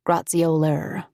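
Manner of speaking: put-on "Graziola" said slowly with a rolled foreign accent, rising; mispronounced name. Narrator echoing how the stranger says "Graziola", slow with his accent. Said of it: "He didn't say her name quite correctly".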